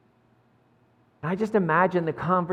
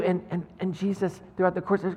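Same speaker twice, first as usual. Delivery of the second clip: ordinary talk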